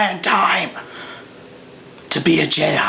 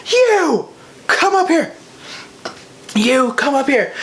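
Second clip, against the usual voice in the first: a lightly voiced show host calling kids up; light mock host; cast member; 9 semitones higher than usual. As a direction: call them up like a cheery host. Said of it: "and he goes".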